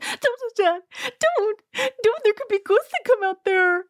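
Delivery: very high, squeaky mock panic; hammed-up high-pitched freak-out; mock panicked squeal. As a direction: squeal it like a terrified scaredy-cat. Mocking frightened housemates in high voice.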